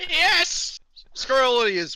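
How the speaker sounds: high-pitched imitation of a cocky winner; hammed-up victory cheer; mock triumphant gloating. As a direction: crow it like a boastful race winner. Mimics boastful winners on the stream.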